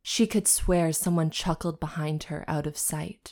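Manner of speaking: hushed narration; whispered story narration. A narrator's reading. Narrator whispering the fanfic scene to listeners. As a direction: read it like a secret.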